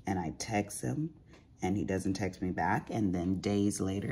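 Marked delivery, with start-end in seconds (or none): none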